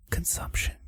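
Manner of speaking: spooky whisper. Acted. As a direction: whisper it eerily.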